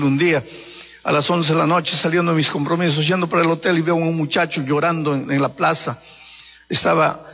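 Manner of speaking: ordinary talk, nothing marked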